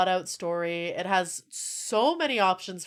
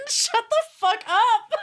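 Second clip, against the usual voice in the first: laughing; 4 dB louder, slower than usual. Real, not acted. Laughing rebuke at game line.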